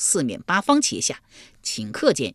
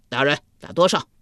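guard voice; 4 dB louder than usual. Acted. Guard asks the magistrate.

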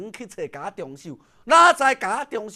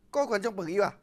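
ordinary delivery, nothing marked

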